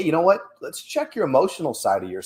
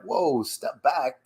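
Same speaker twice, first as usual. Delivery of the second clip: calm self-check; 2 semitones higher, much slower than usual. Retold or imitated. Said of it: "I'm like".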